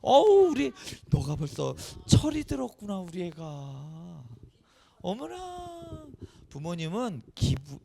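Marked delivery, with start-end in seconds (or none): 0.0-5.8 delighted parent. Acted. Parent praising a child who has matured, hammed-up delight. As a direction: gush like a touched parent, hammed-up. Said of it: "기뻐할 거예요".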